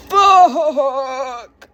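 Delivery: long, high drawn-out swear; stretched-out cry of sudden dread; dismayed curse. Acted. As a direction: wail the swear word in dismay. Remembers report cards are out.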